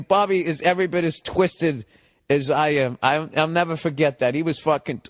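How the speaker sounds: usual speaking voice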